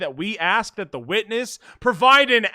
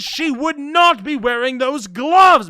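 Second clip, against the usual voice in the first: forceful; 4 semitones higher, 7 dB louder than usual. Acted. Reads objection line forcefully.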